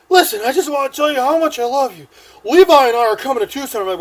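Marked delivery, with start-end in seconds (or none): 0.1-2.1 put-on pleading drunk. 2.4-3.8 put-on loud, raised, eager drunken declaration; drunk announcing. Drunk man announcing the trip to his ex, loud declaration.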